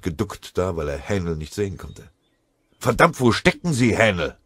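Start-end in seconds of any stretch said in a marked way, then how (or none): 2.8-4.4 loud, high impatient shout; yell, fed up with searching; impatient call. A narrator's reading. Sergeant shouting for his hidden corporal, impatient call. Calling out across the woods.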